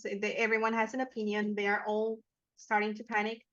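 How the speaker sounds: ordinary delivery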